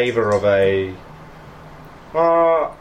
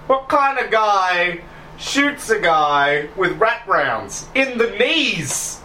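put-on higher, slow outraged old thief; indignant Dougie; 6 semitones higher, much slower than usual. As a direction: drag out the complaint, indignant. Dougie complains about being shot.

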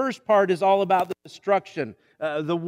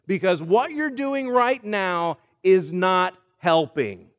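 higher, slow and firm, laying down the law; stern command; 4 semitones higher, 4 dB louder, slower than usual. Acted. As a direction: separate two fighting kids with your voice. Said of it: "what you've gotta say is".

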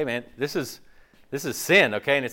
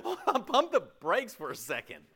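mock disbelief; 12 semitones higher than usual. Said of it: "And you go".

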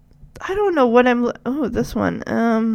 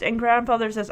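usual speaking voice

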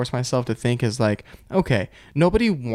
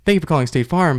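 higher, put-on customer service voice; phone-agent greeting; 4 semitones higher, 4 dB louder than usual. Agent answering a call at a previous job, higher put-on service voice. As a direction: switch straight into a polite phone greeting. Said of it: "have to answer a phone call".

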